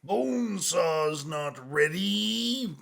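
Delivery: very slow, drawn out promo line; slow mock promo. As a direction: stretch it like a wrestling promo. Bonesaw is ready meme.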